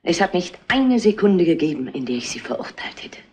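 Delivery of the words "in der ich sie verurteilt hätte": whisper. Acted. Mother defends her daughter quietly.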